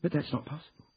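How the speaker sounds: high-pitched, insistent objection; insistent protest. Acted. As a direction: protest to the lawyers in disbelief. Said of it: "insisted Ruth".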